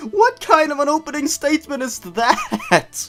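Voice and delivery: high, disbelieving squeak at the line; incredulous. Real, not acted. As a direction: react in amused disbelief. Mocking the story's absurd opening.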